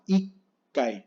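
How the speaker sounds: ikai with a held stop before "kai"; doubled K in ikai as a silent gap; pause in ikai. Shown as a demonstration. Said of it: "con pausa".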